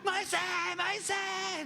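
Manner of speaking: high, slow, sing-out call; insistent calling. Acted. Disciple below pestering Moses, high drawn-out calling. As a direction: pester someone with a long loud call.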